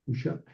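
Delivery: "Hu, shap" as two syllables, no "ve"; abbreviated form. Shown as a demonstration. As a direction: say "Hu, shap" joined, vav silent. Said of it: "se abrevia en hu".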